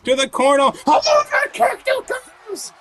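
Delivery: high, shouted play-by-play; wrestling commentator. Real, not acted. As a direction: call every move at fever pitch. Live play-by-play at full hype.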